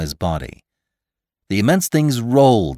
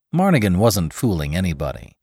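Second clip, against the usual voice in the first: ordinary delivery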